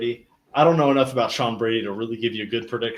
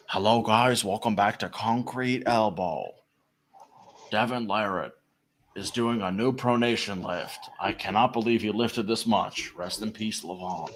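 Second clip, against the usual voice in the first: Concrete Elbow; 2 semitones lower, 5 dB quieter than usual. Pretend.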